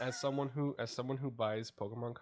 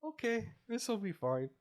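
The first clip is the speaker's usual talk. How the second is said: mock nervous; 9 semitones higher, 7 dB louder, slower than usual. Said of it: "And I was like".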